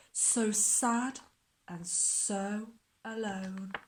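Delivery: put-on lively storybook reading for children; expressive picture book narration; story reading. A narrator's reading. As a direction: read it like bedtime storytime. Reads picture book aloud.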